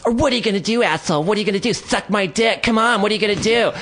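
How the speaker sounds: high-pitched mock screeching; imitating screaming taunts. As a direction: goad him in a shrieky, put-on high voice. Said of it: "was screaming in my face".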